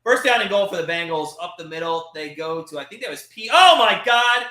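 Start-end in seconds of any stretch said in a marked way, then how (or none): 3.5-4.4 loud, higher outcry; exasperated exclamation. Real, not acted. Fan crying out as the opponent scores, loud outcry. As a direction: cry out in disbelief.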